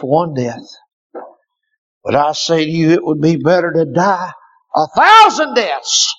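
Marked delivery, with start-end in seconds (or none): 4.7-6.1 high, loud, slowing shout; pulpit climax. Acted. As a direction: drive it home to the rafters. Preaching climax.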